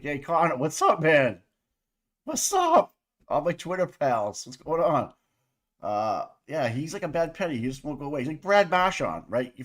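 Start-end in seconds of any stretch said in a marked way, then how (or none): 0.0-5.1 loud, hyped greeting in Tom's manner; imitating Tom. Streamer imitating Tom greeting his Twitter pals, loud and hyped. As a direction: play the buddy who barges in loud and cheery.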